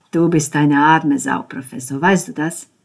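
loud, contemptuous jab; mocking insult. Ben sneering at Konrad, loud and contemptuous. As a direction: sneer it at him.

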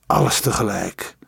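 usual speaking voice

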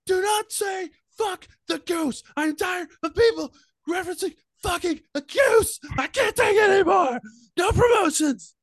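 very high, exasperated screaming; shrieking outrage. Acted. GM voices Jeremy's angry reply.

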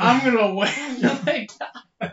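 laughing through the words; laughing while quoting. Retold or imitated. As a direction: quote broken by laughter. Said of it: "now it's already changed to".

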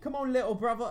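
high, loud mock Cockney; chirpy Cockney sister voice; high, loud Cockney call; Cockney quote. Repeats the Cockney line.

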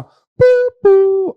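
high, loud ding-dong chime; imitated cabin chime. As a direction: sing out the airplane chime. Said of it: "você ouvir assim". (imitando o sinal sonoro do avião)

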